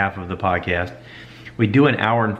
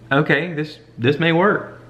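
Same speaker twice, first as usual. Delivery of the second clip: hopeful inner thought; 7 semitones higher, slower than usual. Retold or imitated. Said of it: "And I thought".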